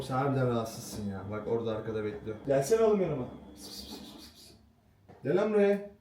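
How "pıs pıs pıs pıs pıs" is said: cat-calling hiss. Real, not acted.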